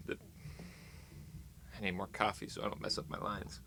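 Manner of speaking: slow, breathy under-the-breath mutter; muttered aside. Acted. Vlogger mutters a joke aside to the camera, slow and unvoiced. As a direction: mumble it slowly to yourself.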